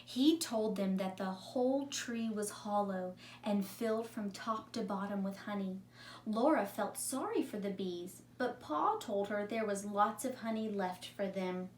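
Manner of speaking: calm read-aloud storytelling voice; story narration. A narrator's reading. Teacher reading a story to her class, calm narration. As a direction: read it like a story for young children. Teacher reads a picture book.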